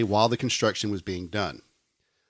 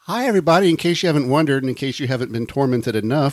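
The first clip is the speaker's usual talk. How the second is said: upbeat announcer welcome; podcast host intro; 3 semitones higher, 6 dB louder than usual. Acted. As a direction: open the show like a host.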